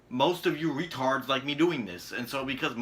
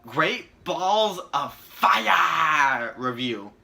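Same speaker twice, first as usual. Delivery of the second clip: announcer shout; 3 semitones higher, 6 dB louder, much slower than usual. Acted. Announcing the review title.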